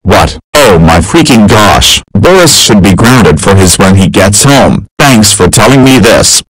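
loud furious yelling dad; angry shout. Acted. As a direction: yell it out in outrage. Father learns son's misdeed.

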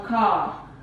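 car with the R dropped, falling tune; British car. Shown as a demonstration. Teacher showing how the British say car. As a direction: say car like a Brit, no R. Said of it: "os ingleses".

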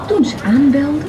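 ordinary delivery, nothing marked

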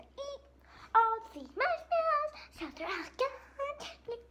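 high voice. Pretend. High slow toy voice.

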